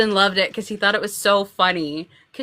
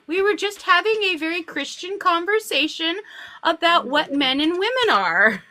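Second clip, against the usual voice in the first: higher-pitched mock-innocent excuse; acted-out prim explanation; innocent grandma; 7 semitones higher than usual. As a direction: play grandma covering for herself.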